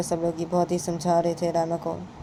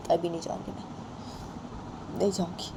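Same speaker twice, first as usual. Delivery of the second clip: whispered refusal; 3 semitones lower, 9 dB quieter, slower than usual. Acted. Quiet repeat of refusal.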